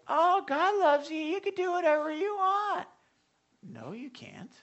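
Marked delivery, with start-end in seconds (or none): 0.1-2.9 syrupy permissive believer. Retold or imitated. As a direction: parody the anything-goes church. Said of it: "the other side of the church".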